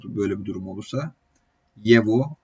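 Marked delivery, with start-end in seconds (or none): 1.8-2.4 "Yevo" with the G sounded as a V. Shown as a demonstration. Teacher shows the class the G-to-V sound in "Yevo". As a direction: say "Yevo", the middle G turned into V. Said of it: "G harfi V sesi çıkarmaya başlıyor".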